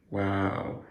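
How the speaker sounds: usual speaking voice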